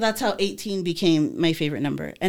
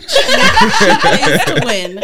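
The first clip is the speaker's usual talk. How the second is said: slow, laughing tease; 13 semitones higher, 11 dB louder, much slower than usual. Real, not acted. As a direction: laugh through the words. Teasing friend about competing.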